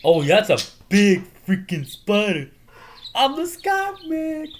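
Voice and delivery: high, loud, slow frightened voice; hammed-up spider panic; scared quote. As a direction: freak out at a big spider. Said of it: "And he's like".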